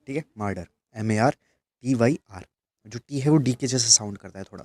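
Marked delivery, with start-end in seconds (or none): 0.4-0.7 मर्डर with a d-like t; pronounced murder. Shown as a demonstration. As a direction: say मर्डर, t sounding like d. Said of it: "इसका pronunciation मर्डर होता है". (मर्डर को डी जैसी आवाज़ से बोलो)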